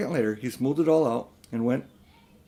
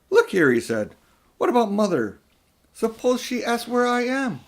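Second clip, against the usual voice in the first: put-on higher, louder anxious boy; worried boy; 11 semitones higher, 4 dB louder than usual. Diggory asking about his mother, worried. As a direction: object urgently.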